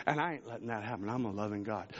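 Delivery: low, soft, quick as God speaking; God gently insisting, low and hushed; voicing God. Preacher voicing God, low and soft.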